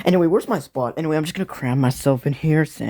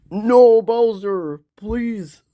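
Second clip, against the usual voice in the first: pleading; 6 semitones higher, 3 dB louder, much slower than usual. Acted. Begs dying Bowser to live.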